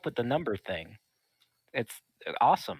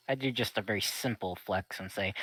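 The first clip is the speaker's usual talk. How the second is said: ordinary talk, nothing marked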